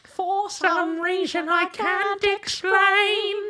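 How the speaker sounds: very high, slow British witch voice; British witch accent. Shown as a demonstration. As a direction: sing it out like a ghoulish British witch. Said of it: "sounding like a British witch".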